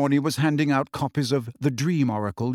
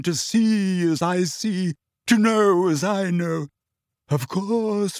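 dramatic wavering=71; 5 semitones higher, slower than usual. A narrator's reading. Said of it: "in a deep, dramatic, wavering voice".

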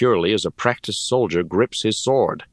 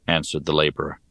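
plain reading voice

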